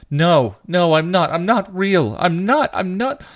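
very high, loud, repeated denial; wailing, growing hysterical. A narrator's reading. Teen refuses her sister's comfort, wailing. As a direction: let it climb into hysteria. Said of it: "began to wail, growing hysterical".

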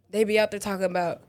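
ordinary delivery

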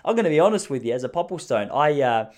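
ordinary talk, nothing marked

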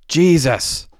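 high cry of pain; contained narrated scream of pain; agonized scream. A narrator's reading. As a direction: cry out in agony. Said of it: "he screamed in agony".

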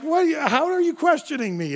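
high-pitched, put-on offended squeak; comic disbelief, voice jumping way up; mock indignant. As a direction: play up the wounded pride. Retells his outrage at the ski patrol.

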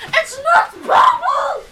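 high shouted protest; shouted correction. Acted. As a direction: yell the correction. Correcting the name.

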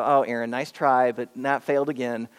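low, unimpressed mock praise; deadpan, lightly mocking pity; eye-rolling dismissal. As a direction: play a listener rolling their eyes, pitch dropped. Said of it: "kinda roll your eyes and go".

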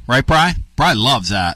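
ordinary talk, nothing marked

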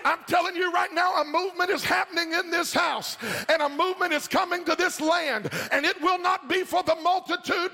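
high-pitched, sustained revival preaching; fervent preaching. Acted. Preaches at full force to congregation.

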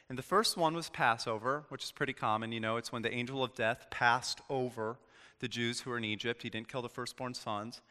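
ordinary talk

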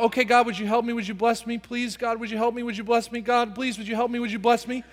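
mock someone timidly begging over and over; meek repetitive pleading. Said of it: "I'm sad that this is the way people live".

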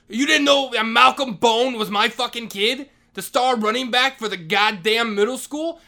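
mock indignant yell. Vice principal defending his son, loud indignant yell. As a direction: shout it like an offended boss. Retold shouting match with principal.

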